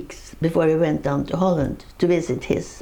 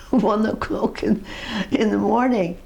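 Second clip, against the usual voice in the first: laughing; 5 semitones higher than usual. Real, not acted. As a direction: let the laugh spill into the words. Laughing telling the punchline.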